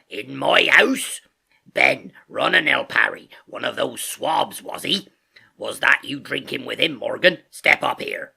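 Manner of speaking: loud, drawn-out sailor voice giving orders; Silver crying out. A narrator's reading. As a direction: bellow indignantly across your tavern. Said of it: "cried Silver".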